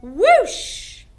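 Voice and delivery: loud rising whoosh; ghostly whoosh. Shown as a demonstration. Teacher shows the class a ghost swooping. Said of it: "Ghosts swoop".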